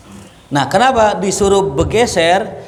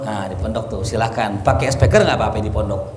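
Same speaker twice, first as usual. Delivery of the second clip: ordinary delivery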